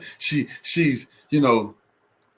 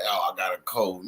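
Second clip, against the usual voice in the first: mocking a sick old man; 5 semitones lower, 6 dB quieter than usual. Commentator mocks the president's cold excuse, lower and softer. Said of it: "You there".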